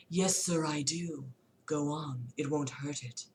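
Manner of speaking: quiet, low, soothing; calm him like a gentle painter; soft Bob Ross voice. Acted.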